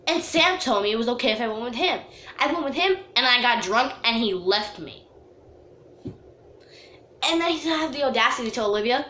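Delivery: ordinary talk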